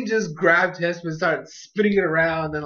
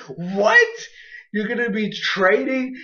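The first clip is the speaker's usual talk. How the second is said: mock freak-out; 5 semitones higher, slower than usual. Farfetch'd reacting to news of a trade, freaking out. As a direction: act out a character losing it. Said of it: "He's, like, freaking out over here".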